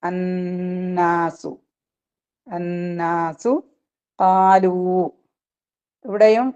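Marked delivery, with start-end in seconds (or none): 0.0-1.5 അന്നാസു very slow, double n held through the nose, falling; recited അന്നാസു with ghunnah; nasal ghunnah held two counts. Shown as a demonstration. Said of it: "രണ്ട് count മാനിച്ചിട്ട്". 2.5-3.5 അന്നാസു very slow, double n held through the nose, falling; recited അന്നാസു with ghunnah; nasal ghunnah held two counts. Shown as a demonstration. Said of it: "രണ്ട് count മാനിച്ചിട്ട്".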